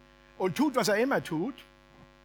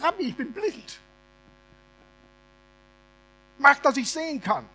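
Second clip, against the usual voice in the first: pleading; 7 semitones higher, 4 dB louder than usual. Acted. Blind man pleading to Jesus.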